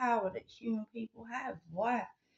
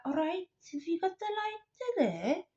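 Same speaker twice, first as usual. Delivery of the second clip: high, lilting Welsh voice; Welsh accent; 8 semitones higher, 4 dB quieter than usual. Retold or imitated. Stranger popping up to ask for a lighter, high Welsh impression. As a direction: imitate a small Welsh woman, pitched well up. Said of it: "proper Welsh".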